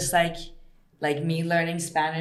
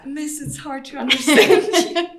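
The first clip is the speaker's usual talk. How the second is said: laughing; 11 semitones higher, 9 dB louder than usual. Retold or imitated. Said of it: "to just say that".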